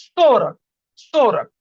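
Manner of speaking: সোরাক said loud and slow as a model for the class; clear model pronunciation. Shown as a demonstration. Teacher models সোরাক for students to repeat, loud and deliberate. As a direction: sound out সোরাক clearly and firmly so students can copy it. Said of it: "চল্লিশে যে কি হবে?".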